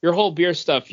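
ordinary delivery

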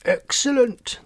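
loud, high triumphant cry; booming. A narrator's reading. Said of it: "the three toads boomed".

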